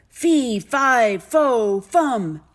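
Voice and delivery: loud booming chant, pitch stepping down; giant's shout. A narrator's reading. Giant suddenly appearing before Jack, booming shout. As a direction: bellow each word separately like a giant.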